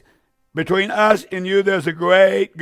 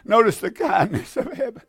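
laughing; 3 semitones higher, 3 dB quieter than usual. Real, not acted. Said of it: "repeated with a laugh".

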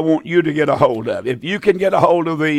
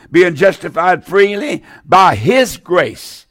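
slow emphatic reading; slower than usual. Shown as a demonstration. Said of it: "Listen now".

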